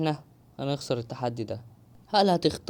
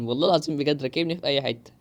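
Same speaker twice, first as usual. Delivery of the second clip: laughing through the repeat. Real, not acted. Gamer laughing at his own bad luck in the game. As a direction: laugh it out in disbelief.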